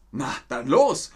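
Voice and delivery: loud, keen go; eager call. Acted. Hedgehog calls to the hare to start, loud.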